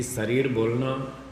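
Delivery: शरीर as "sarir", plain "s" first; mispronunciation. Shown as a demonstration. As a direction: open शरीर on "s" instead of "sh". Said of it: "अशुद्ध है".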